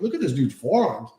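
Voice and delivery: ordinary delivery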